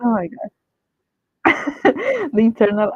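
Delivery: normal talking voice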